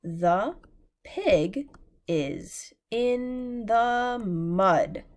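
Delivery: the pig is in the mud, each word slow and separate; slow choral reading. Shown as a demonstration. Teacher rereading the sentence with class, very slow. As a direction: read it slowly together again. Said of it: "let's read it together one more time".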